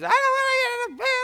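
very high screechy imitation of a child; mock tantrum scream. Dad imitating daughter refusing the van, mock tantrum scream. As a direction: wail it like a kid mid-tantrum. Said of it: "she starts screaming".

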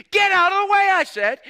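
high, loud barking yell; angry dad shout. Retold or imitated. Father yelling at his son by the trailer, loud angry shout. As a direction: bark it at the kid to move. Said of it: "He went".

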